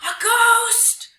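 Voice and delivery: high, loud cry of alarm; terrified scream. Acted. Animals at the waterhole spotting a ghost, screaming. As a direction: scream it out in panic. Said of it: "the animals screamed".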